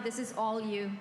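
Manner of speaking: normal talking voice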